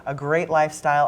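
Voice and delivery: normal talking voice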